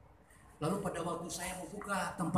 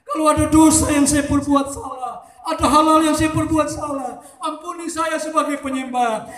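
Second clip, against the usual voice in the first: high, loud preacher's peak; fervent shouted preaching; preaching at full force; 10 semitones higher, 13 dB louder than usual. Acted. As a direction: drive the worship call home.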